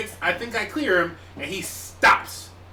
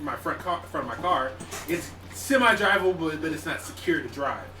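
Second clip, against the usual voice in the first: ordinary talk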